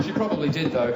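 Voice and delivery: normal talking voice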